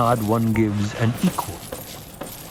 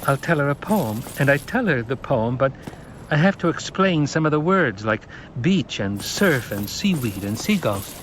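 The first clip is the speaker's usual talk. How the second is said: put-on higher boy's voice, earnest; young boy; 5 semitones higher than usual. Paul answering the Reverend Mother, higher boyish voice.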